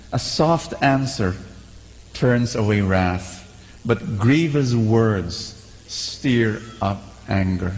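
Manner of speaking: slow, solemn Bible reading; scripture reading. Shown as a demonstration. Preacher reading Proverbs to the congregation, solemn and slow. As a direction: read Proverbs slowly and gravely. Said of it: "I'll read it for you".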